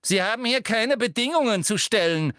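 high, sharp authoritative rebuke; brusque. A narrator's reading. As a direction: put the pupil firmly in place. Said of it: "erwiderte Professor Umbridge schroff".

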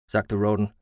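ordinary delivery, nothing marked

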